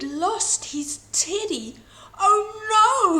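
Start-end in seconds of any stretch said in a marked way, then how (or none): 2.2-3.2 high, loud panicked cry; frantic dismay. A narrator's reading. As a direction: gasp it out in a panic. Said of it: "frantically searching his room".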